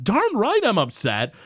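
lightly voiced upset boy; imitating angry Bart. Bart tells his parents he is upset, in a high indignant voice. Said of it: "And he's like".

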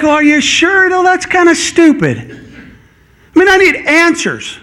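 a touch of mock disbelief; sceptical scoff. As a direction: scoff at the idea of praying. Congregation voiced doubting scripture.

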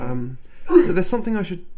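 ordinary talk

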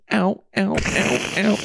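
high mock cries of ow; faking pain. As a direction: yelp ow like you're hurt. Joking he was kicked out.

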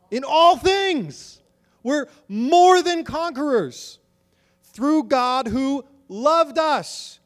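each word drawn out and ringing; a preacher thundering the verse back at the church; high, loud, slow proclamation. Acted.

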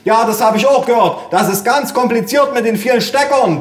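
high, loud oblivious holler; bellowing mother. A narrator's reading. Mother agreeing from afar, bellowing. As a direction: shout along as if you understood. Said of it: "brüllte meine Mutter".

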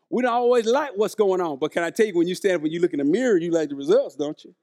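normal talking voice

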